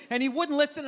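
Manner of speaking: ordinary delivery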